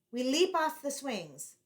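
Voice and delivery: usual speaking voice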